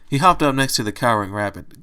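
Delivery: ordinary delivery, nothing marked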